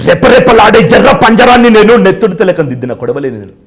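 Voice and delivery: fiery dubbed-hero proclamation that eases down; Sai Kumar. Retold or imitated. Hero in a flashback proclaiming himself, loud then settling. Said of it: "Sai Kumar గారి voice common కదా".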